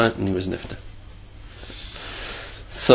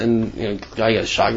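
ordinary talk, nothing marked

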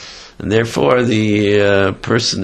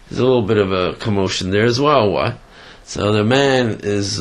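ordinary talk

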